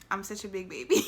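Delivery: laughing at herself; giggly self-mocking. Real, not acted.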